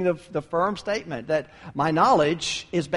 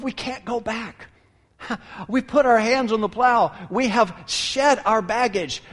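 impassioned, pleading and higher; heartfelt plea; 7 semitones higher, 5 dB louder than usual. Acted. Peter pleading with Jesus, impassioned and higher. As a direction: cry out that there's no going back. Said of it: "A heartfelt statement of".

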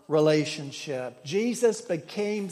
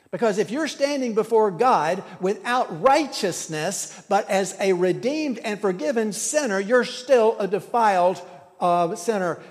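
louder, rising preacher's fervour; preaching; 4 dB louder than usual. Acted. Pastor presses forgiveness versus righteousness on congregation, fervent preaching. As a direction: build the sermon up with fire. Preaching builds to full force.

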